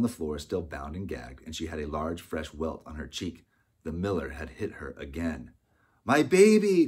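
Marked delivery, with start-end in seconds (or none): 6.0-6.9 high, very loud anguished cry. A narrator's reading. Opal rushing toward her baby, crying out. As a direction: cry out for your child. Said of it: "cried Opal".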